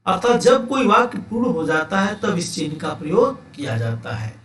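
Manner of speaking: normal talking voice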